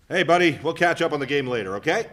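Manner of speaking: father promising his son a game; cheerful dad. Acted. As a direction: perk the kid up.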